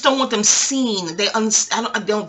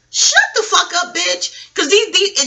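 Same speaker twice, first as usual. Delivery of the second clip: high, loud snap at them; angry outburst; 8 semitones higher, 5 dB louder, slower than usual. Real, not acted. Ranting at rich people about the homeless, angry and loud. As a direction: cut them off hard.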